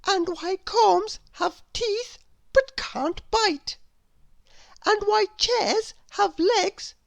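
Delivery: put-on a curious little girl asking sweetly; little Miss Curious voice. Narrator voices Miss Curious asking her door. Character voice.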